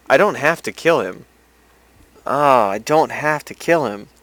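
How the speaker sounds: suddenly excited by the idea of mercy; excited realization. Real, not acted. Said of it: "excited realization".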